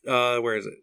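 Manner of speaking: ordinary talk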